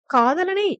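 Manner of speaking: pointed emphasis on காதலனை; stressed word. A narrator's reading. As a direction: lean hard on காதலனை. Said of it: "அழுத்தமாய் கூறியபடி".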